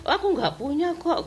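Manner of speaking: high, sing-song mock of a coy denial; mocking quote. Mimicking a humble-bragging woman, high and mocking. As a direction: squeak it out like a falsely modest person.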